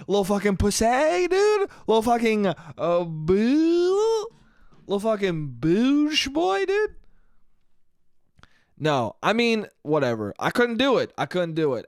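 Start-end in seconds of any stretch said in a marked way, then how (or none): 0.1-7.0 swooping up into a squeaky falsetto; goofy insults that slide high at the ends; silly self-mockery. Comic calls himself names over spitting a shot, silly squeal.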